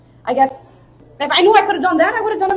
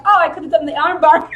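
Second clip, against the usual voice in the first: loud, raised-pitch laughing self-mockery; hammed-up eureka, amused at yourself; belated realization; 6 semitones higher, 8 dB louder than usual. Mocking her own late realization.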